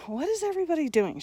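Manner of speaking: quick, high bewildered question; asking baffled, rushed and high; puzzled surprise. Retold or imitated. Retold confused teen question.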